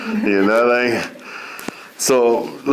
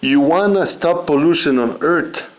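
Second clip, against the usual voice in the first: higher-pitched rhetorical question; imitated uncle; 7 semitones higher than usual. Uncle setting up his simple advice, raised imitated voice. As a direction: pose it as the uncle would. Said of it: "My uncle would say like that".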